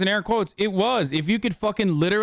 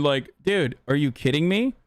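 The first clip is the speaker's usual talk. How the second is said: normal talking voice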